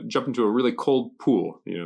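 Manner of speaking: ordinary talk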